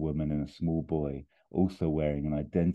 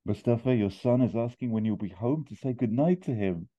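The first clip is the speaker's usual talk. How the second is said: higher-pitched wife's voice on the phone; mum; 6 semitones higher than usual. Acted. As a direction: press him gently, pitched up as the wife. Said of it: "And the mum says".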